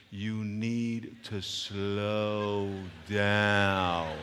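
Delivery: extremely slow, drawn-out instruction; exaggerated slow-motion coach. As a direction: stretch every word to the limit. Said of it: "She looked me in the eye like this".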